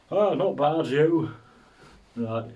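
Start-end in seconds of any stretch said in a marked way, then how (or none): 0.1-1.3 chirpy reply. Retold or imitated.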